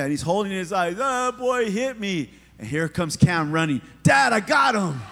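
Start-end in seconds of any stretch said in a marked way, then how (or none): 1.0-2.3 higher-pitched imitation of a whining child; hammed-up tearful tattling, falling away at the end; mock crying. Crying kid runs to his mom to tell, mock tearful. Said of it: "So he's crying". 4.0-5.0 put-on loud, high, excited boy's yell; triumphant kid shout. Son running to his dad after hitting back, excited shout.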